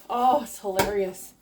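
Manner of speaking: normal talking voice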